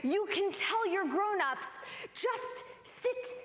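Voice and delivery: lightly voiced, high, placating teacher; calm the child down, a bit flustered; teacher placating the toddler, high and soothing. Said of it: "she says".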